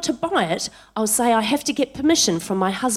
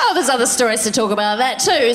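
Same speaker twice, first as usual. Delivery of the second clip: usual speaking voice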